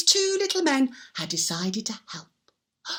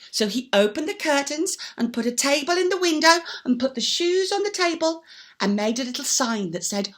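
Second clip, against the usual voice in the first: ordinary delivery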